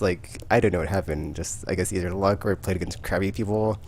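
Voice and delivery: ordinary talk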